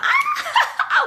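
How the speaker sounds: very high squeal breaking into laughter; mock passenger shriek; imitated scream. Passengers slide in a speeding car, high mock scream.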